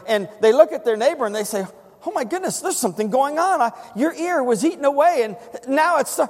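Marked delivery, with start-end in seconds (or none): 2.1-6.2 high-pitched astonished discovery; amazed. Acted. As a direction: marvel excitedly at your neighbor's healing. Amazed lepers noticing healing, voiced.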